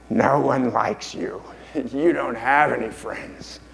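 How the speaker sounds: slow, put-on creature voice; a slow taunt from a hissing-mad alter ego; Gollum. Lecturer voices Gollum taunting Smeagol, slow creature voice. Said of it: "the golem character says".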